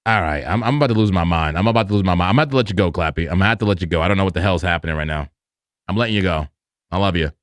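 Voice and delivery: low-pitched, weary giving up; resigned. Real, not acted. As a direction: let the assistant go in a low, resigned voice. Giving up on broken assistant.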